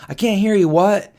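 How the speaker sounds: calling out. Retold or imitated. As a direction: call out to someone you can't hear. Kid calling to quiet stranger.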